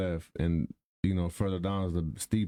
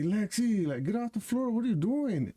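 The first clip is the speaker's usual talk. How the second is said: calling out; 11 semitones higher than usual. Retold or imitated. Dad calling his daughter off the hill, high alarmed call. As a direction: yell across the hill, high and urgent.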